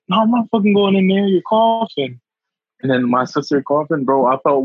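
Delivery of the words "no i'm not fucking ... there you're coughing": high, mocking mom refusal; mom refusing. Retelling his mom refusing to enter the sick room, high mocking voice. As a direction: act out mom flatly refusing to go in. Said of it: "when my mom said".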